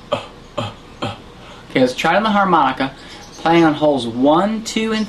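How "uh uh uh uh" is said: breathy voiceless coughs; silent throat coughs. Shown as a demonstration. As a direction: push air out of an open throat, no voice. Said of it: "Throat is relaxed and open".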